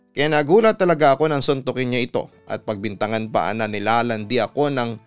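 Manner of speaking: usual speaking voice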